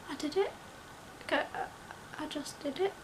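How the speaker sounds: soft, hesitant, questioning lilt; quiet disbelief. Retold or imitated. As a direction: say it small, as if it's only sinking in. Retold dazed disbelief after passing.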